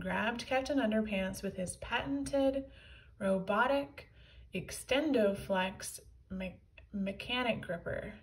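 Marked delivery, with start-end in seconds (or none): none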